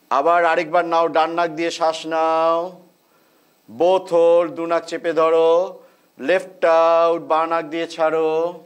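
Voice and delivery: slow, measured breathing instructions; guided breathing cues. Real, not acted. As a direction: call each breath step calmly. Leads alternate nostril breathing.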